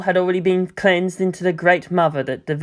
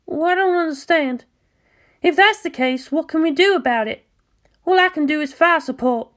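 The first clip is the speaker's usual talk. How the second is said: put-on higher, shy and eager-to-please girl; timid pilot Mayumi; 12 semitones higher than usual. Mayumi answering Asuka over the comm, higher shy voice. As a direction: voice Mayumi high and hesitant, like a nervous junior.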